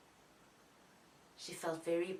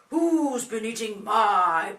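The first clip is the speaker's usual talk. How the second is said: big, loud, slow Daddy bear; deep Daddy voice; 13 dB louder, slower than usual. Shown as a demonstration. Daddy bear finds his porridge eaten, deep booming voice. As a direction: boom it out slowly as a deep-voiced father bear. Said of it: "Daddy says in a deep voice".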